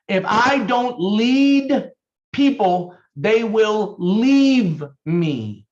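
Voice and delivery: slow, forceful repeat; emphatic slow dictation. Shown as a demonstration. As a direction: drive the rule home for note-takers. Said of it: "Write it down".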